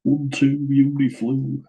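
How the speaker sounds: hammed-up Greater Manchester take on French words; Shaun Edwards in French. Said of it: "I imagine Shaun Edwards with the Wigan accent".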